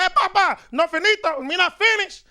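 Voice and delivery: high loud imitated Spanish-accented grandpa; hammed-up accented old man; grandfather's accent. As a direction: mimic the grandfather insisting. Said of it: "The grandfather said".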